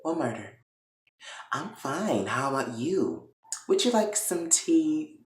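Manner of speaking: very soft, unbothered hostess; smooth, feigned innocence. Retold or imitated. Catherine brushing off the murder to Veronica, smoothly innocent. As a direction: play it off quietly, lifting into the offer. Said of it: "Catherine played this shit off so smooth".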